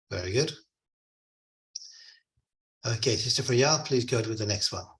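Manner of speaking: ordinary delivery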